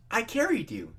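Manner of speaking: a hint of pride in Twilight; lightly voiced cheerful Twilight; lightly beaming Twilight. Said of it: "she beamed".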